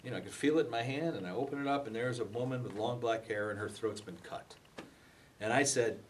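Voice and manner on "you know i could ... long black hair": ordinary talk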